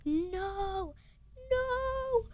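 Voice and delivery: high, drawn-out mock wail; Harry crying out. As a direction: imitate a dismayed cry, stretching each no. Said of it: "and Harry's like".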